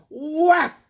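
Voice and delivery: high, punchy impact cry; comic hit noise. Retold or imitated. Host voicing a comic fight panel, high punchy cry. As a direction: yelp the blow like a cartoon. Sound effect of comic kick.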